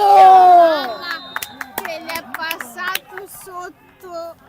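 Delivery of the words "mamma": loud anguished cry, pitch falling; dismayed shout. Real, not acted. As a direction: cry out as the ball slips past. Groans at a missed chance.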